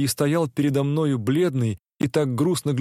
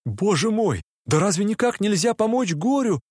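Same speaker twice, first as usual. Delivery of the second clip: despairing cry; 6 semitones higher than usual. A narrator's reading. The dreamer jumps up and cries out in despair to Nastenka. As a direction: cry out helplessly, pitch climbing. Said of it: "закричал я... в совершенном отчаянии".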